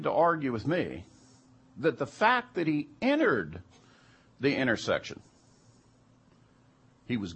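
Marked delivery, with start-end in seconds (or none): none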